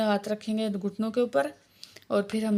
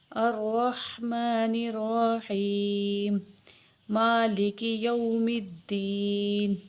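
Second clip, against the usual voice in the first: slow, melodic tajweed chant; Quranic recitation; much slower than usual. Shown as a demonstration. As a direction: recite devoutly, drawing out vowels. Recites basmala and Fatiha.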